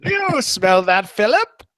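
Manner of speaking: put-on high, slow old British lady accent, calling to her husband; prim elderly Englishwoman asking her husband, high and slow; old woman's British accent, rising high on the name. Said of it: "do your old woman British accent".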